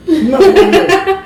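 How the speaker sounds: high laughing squeal; squealing protest. Real, not acted. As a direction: shriek it through a laugh. Kid protests hair being touched.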